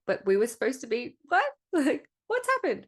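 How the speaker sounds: squeaky, incredulous, building to a high laughing peak; retold disbelief. Coach losing connections, mock baffled protest rising to a squeak. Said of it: "I got whiplash over here being like".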